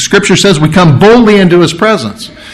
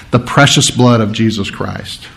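normal talking voice

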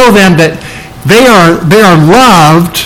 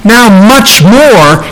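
MUCH MORE drawn out with emphasis; slow, weighty stress on "much more"; emphatic; 4 semitones higher, much slower than usual. Shown as a demonstration. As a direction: lean hard and long on "much more". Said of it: "those two words are very emphatic".

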